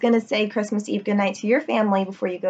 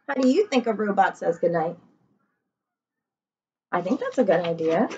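ordinary talk

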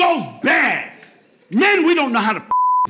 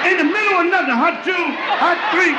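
quarterback call; 5 semitones higher than usual. Retold or imitated. Cheated man mimicking football player, quarterback call. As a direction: call the snap count, stretched and high.

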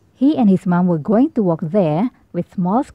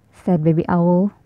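plain reading voice

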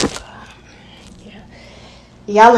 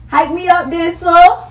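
higher and louder, rising at the end; hyped-up demand; 9 semitones higher, 6 dB louder than usual. Shown as a demonstration. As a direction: pump it up, demanding the hype.

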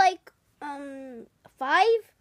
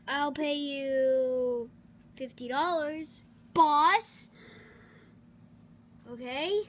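normal talking voice

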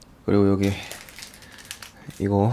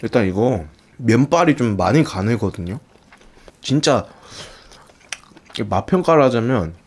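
speaking between bites; talking while chewing noodles. Real, not acted. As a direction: mouth full of noodles. Seen 0: Slurps noodles just before, cheeks full, chewing between words throughout.

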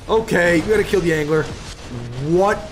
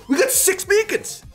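excited. Real, not acted. Boasting after loss.

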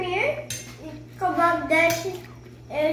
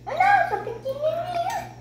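high little voice; 14 semitones higher than usual. Pretend. Child insisting she is small.

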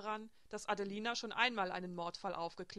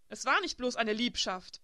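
louder, defiant objection; rebellious protest; 2 semitones higher, 8 dB louder than usual. A narrator's reading. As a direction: push back hard, indignant. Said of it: "begehrte Elsbeth indes auf". (trotziger, lauter Widerspruch)

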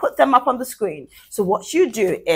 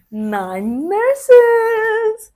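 sing-song phrase; 12 semitones higher than usual. Shown as a demonstration. Teacher modeling alliterative phrase.

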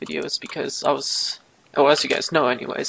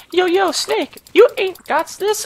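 much higher, louder mock-bro taunt; mocking friends; 14 semitones higher, 9 dB louder than usual. Friends taunting him he can't do YouTube, mock taunt. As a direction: taunt like a jeering buddy. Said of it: "They say".

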